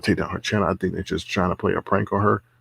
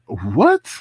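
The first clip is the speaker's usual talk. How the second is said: retold disbelief; 3 semitones higher, 4 dB louder than usual. Retold shocked reaction.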